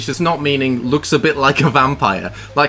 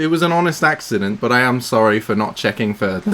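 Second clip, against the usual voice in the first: ordinary delivery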